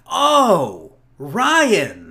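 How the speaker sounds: high, loud "oh" of realization; sudden recognition. Retold or imitated. Friend reads the name tag, surprised and loud. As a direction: act out the penny dropping. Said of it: "and he's like".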